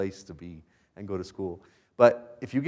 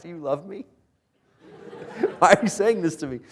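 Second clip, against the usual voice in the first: higher plea broken by laughter; mock hurt, laughing; 7 semitones higher, 4 dB louder than usual. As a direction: laugh through a fake wounded plea. Mock hurt reply to his wife.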